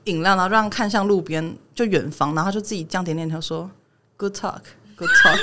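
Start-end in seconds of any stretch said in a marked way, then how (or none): 4.2-5.4 much higher, slow self-satisfied good talk; smug magician. Retold or imitated. 魔术师望向远方自我满足地说，语调高而慢. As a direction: nod along smugly, pleased with a talk no one had. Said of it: "然后他最后说".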